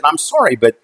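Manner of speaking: retold plea to be taken seriously; retold arguing. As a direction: press the point urgently. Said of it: "we had this huge argument".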